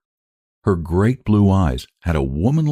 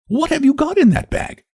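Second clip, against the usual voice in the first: sharp, much higher question. A narrator's reading. Lottie questions the girl about her bag, sharply. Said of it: "said she, rather sharply".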